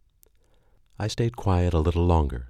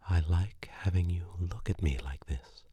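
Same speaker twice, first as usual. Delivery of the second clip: hushed unvoiced whisper; whispered repeat; 7 dB quieter than usual. Girlfriend echoing his line in a whisper. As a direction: breathe the words back to him.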